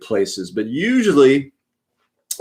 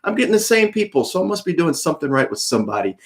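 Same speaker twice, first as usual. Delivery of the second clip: retold pep talk; 8 semitones higher, much faster than usual. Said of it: "But I says".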